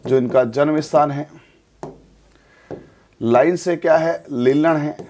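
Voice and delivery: ordinary talk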